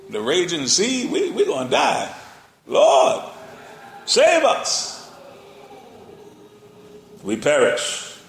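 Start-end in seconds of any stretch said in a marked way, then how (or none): 1.1-2.1 mock panic. 2.7-8.2 high, loud desperate plea; panicked cry. Acted. Frightened disciples begging Jesus to save them, loud and high.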